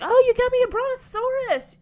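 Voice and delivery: high, loud burst of delight; excited gift reaction. Retold or imitated. Acting out child delighted by gift.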